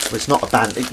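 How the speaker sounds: normal talking voice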